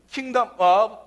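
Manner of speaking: ordinary talk, nothing marked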